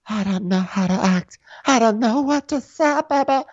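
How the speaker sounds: very high, drawn-out caricature; slow squealing mock helplessness; Jennifer. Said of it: "she's sitting there and she's like".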